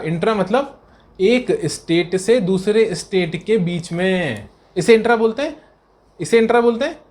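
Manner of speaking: ordinary talk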